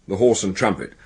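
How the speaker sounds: ordinary talk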